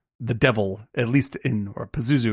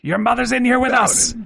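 imitating the priest, pitched up and a bit louder; a raised, firm quote of the priest; priest's line, raised; 11 semitones higher, 4 dB louder than usual. Imitating the priest telling the possessed girl, raised and firm. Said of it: "and he's like".

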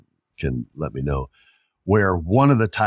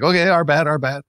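mock sheepish crowd; 8 semitones higher than usual. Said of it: "the crowd legit was like".

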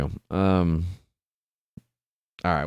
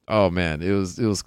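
normal talking voice

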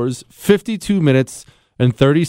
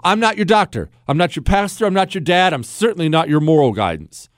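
usual speaking voice

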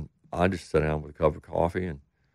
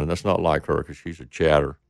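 ordinary delivery

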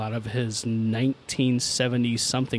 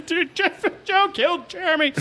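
very high, hammed-up tearful wail; mock crying. Kid running home to tell dad, mock crying. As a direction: squeal it like a crying kid tattling. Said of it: "I'm crying".

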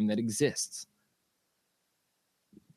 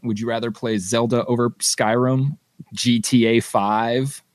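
ordinary delivery

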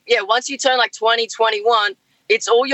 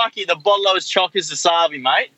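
full-speed slang; 4 semitones lower than usual. Shown as a demonstration. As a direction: rattle off thick Aussie slang at full speed. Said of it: "That was at full speed".

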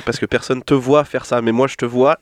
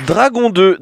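excited announcement; 7 semitones higher, 4 dB louder, slower than usual. Real, not acted. Announcing next segment.

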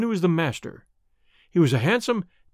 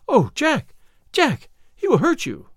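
high-pitched frantic warning; distracted cry; 9 semitones higher, 4 dB louder than usual. A narrator's reading. Woman warning her sweetheart he'll be hurt, distracted cry. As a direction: call out in alarm, voice raised high. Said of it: "cried poor distracted Eddie".

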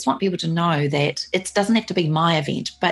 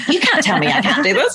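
high, indignant retold protest; defiant inner voice; 9 semitones higher, 4 dB louder than usual. Runner refusing to be pulled from the race, defiant. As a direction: refuse outright, with a laugh in it. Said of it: "this voice went".

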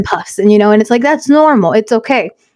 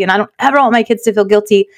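usual speaking voice

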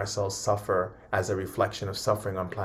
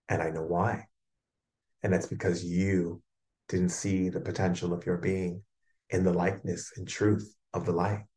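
calm reassurance. Pretend. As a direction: comfort a scared child gently. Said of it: "And you simply say".

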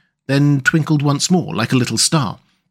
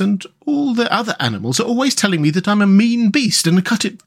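high-pitched character voice; protesting Rat; 8 semitones higher than usual. Acted. Rat telling Mole of the others' complaints, high voice. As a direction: report the criticism in a lifted voice.

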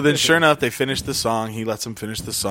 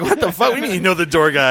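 excited disbelief; 6 semitones higher, 5 dB louder than usual. Retold or imitated. Friends' excited reaction retold.

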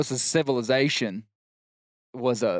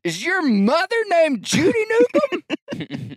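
put-on slow, high drunken holler; hammed-up drunk shout; drunk man's question; 4 dB louder, slower than usual. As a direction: yell it across the bar, swaying. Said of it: "he is drunk".

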